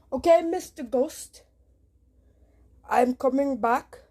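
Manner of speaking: put-on Indian accent to cover nerves; nervous Indian accent. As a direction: Indian accent, calling out to Mister Ghost. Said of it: "My voice turned, um, Indian".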